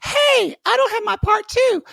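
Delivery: very high shouted complaint; squeaky listener voice. Pretend. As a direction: shriek it like a confused fan. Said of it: "So if you're like".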